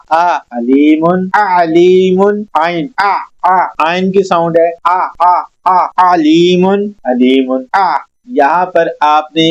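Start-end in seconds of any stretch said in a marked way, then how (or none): 0.1-8.0 आ in अलीमुन said from mid-throat with a sharp glottal break; ain with a catch. Shown as a demonstration. Tutor showing the throat catch of ain in alimun. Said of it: "झटका सा लेना है".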